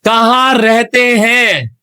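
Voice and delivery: कहाँ रहते हैं stretched word by word; slow repeat. Acted. Interviewer repeats for hard-of-hearing guest, कहाँ रहते हैं slow and drawn out.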